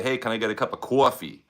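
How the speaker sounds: in a New York accent, "coffee" said as kwaw-fee with a rounded vowel. Shown as a demonstration. Ordering coffee at Starbucks in a New York accent. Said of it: "But you gotta say".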